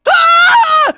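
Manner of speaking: loud, very high scream. Acted. Fighter crying out, loud high-pitched scream. Battle clips, screaming before entrance.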